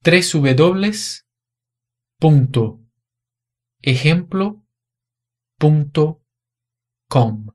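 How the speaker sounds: "três W W W ponto ejemplo ponto com" read in Spanish, ponto as "punto"; teacher modelling a web address read aloud in Spanish. Shown as a demonstration. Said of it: "é falado assim".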